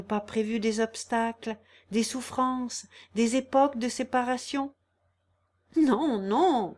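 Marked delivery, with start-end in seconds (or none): 5.8-6.7 Émile refusing to accept the separation, urgently; agitated denial. A narrator's reading. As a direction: push back with an urgent "non, non". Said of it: "dit Émile".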